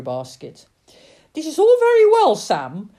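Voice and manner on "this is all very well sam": high, loud, fast shout; mother's shrieked protest. A narrator's reading. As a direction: shout up to someone in the wind. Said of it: "she shouted to him".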